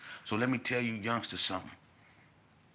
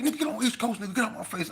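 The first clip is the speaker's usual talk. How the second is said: mock threat; 13 semitones higher, 3 dB louder, faster than usual. Cali boy threatening again.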